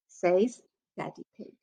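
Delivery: usual speaking voice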